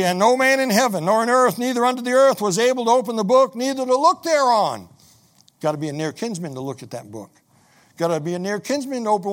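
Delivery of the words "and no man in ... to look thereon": higher, louder preaching read; preached scripture reading. Acted. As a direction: proclaim the verse to the congregation. Reads scripture aloud.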